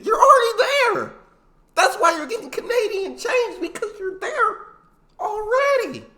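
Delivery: high-pitched, loud laughing shriek of disbelief; incredulous outburst. Real, not acted. Host reacting to a tourist's silly remark, incredulous shriek. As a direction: shriek it in amused exasperation.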